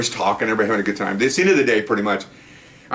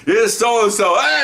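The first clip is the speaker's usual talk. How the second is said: winner announcement; 9 semitones higher, 3 dB louder, slower than usual. Retold or imitated. Announcing second place, then cheer.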